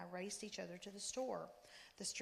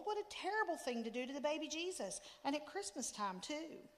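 wailing; 6 semitones higher, 3 dB louder than usual. A narrator's reading. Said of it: "I wailed".

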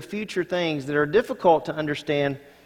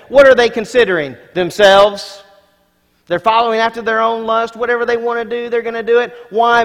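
louder, higher, pressing preacher voice; preaching at full force; 7 semitones higher, 8 dB louder than usual. Acted. Preacher warning the congregation about mockers, at full force. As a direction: drive it home to the church.

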